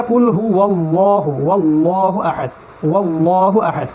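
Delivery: আল্লাহু আহাদ slow, with a heavy ল. Shown as a demonstration. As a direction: recite আল্লাহু আহাদ slowly, with a full ল. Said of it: "না, আসলে".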